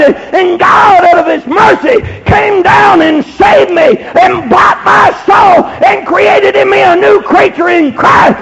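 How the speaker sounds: high, driving sermon climax; full-force preaching. Acted. Preacher testifying how God saved and called him, full force. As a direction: preach it out hard, punching every phrase.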